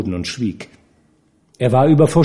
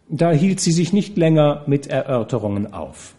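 ordinary delivery

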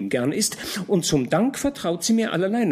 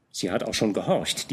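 ordinary delivery, nothing marked

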